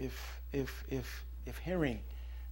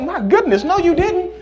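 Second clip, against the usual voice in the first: high, loud laughing disbelief; shocked; 18 dB louder than usual. Acted. Insulted man reacting to the bird, shocked and laughing. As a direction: gasp it out, scandalised.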